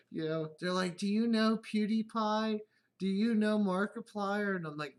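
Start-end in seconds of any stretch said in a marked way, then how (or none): 1.0-4.5 put-on higher, louder, slow kid quiz. Kids quizzing dad about big YouTubers, high eager voice. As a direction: ask it like an eager child.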